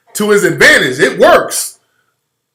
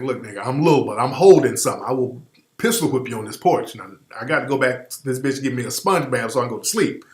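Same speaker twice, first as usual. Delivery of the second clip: lightly voiced little man, lower and softer, calmly threatening; a hint of a tiny tough guy, quiet menace; Emmanuel Lewis voice; 7 semitones lower, 7 dB quieter than usual.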